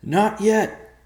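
loud, high call from below; high-pitched yell across a distance. Acted. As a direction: shout it back up. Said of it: "Evercloud called".

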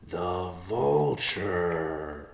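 very slow, dawning The vulture; slow epiphany. Acted. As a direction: stretch The vulture out as it dawns. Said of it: "Riverpaw said in slow epiphany".

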